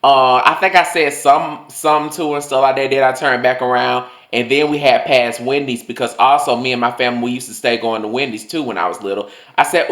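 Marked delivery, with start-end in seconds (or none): none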